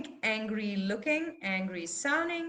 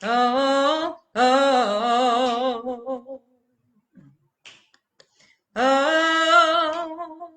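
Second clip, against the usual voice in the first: growing angry ohs; 9 dB louder, much slower than usual. Shown as a demonstration. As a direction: let anger spread through each long vowel. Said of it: "allow it to spread".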